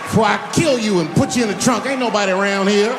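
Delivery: high, loud pretend threat; mock furious outburst. Comic pretending he cut off his critic, hammed-up angry shout. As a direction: blow up and shout her down, hammed-up. Imagined outburst, then admits kidding.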